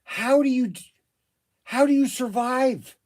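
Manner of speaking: high, loud, incredulous question; can't believe what you're hearing; stunned disbelief. Real, not acted. Shop owner asking the rep, loud and incredulous.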